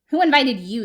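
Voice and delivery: loud, irritated question; grumpy challenge. Acted. Cactus rebuffing a lizard, loud and grumpy. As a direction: throw it at an unwelcome guest.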